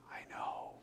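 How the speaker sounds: soft whispered agreement; whispered reply. Retold or imitated. Answering the angry patient close up, whispered. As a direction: whisper back gently.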